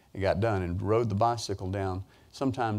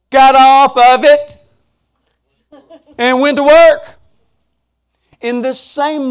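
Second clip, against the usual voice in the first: preaching shout; 18 dB louder, much slower than usual. Acted. Preacher's punchline at full force.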